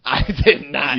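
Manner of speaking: loud, drawn-out laughing protest; laughing denial. Real, not acted. Host denies the accusation, loud and laughing. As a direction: protest loudly through laughter.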